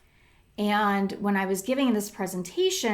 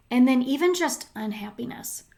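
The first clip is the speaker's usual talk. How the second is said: normal talking voice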